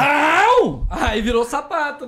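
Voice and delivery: high yelp stretched into a whine; mock pained cry. Joking about the arm, a high pained yelp. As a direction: howl like it hurts.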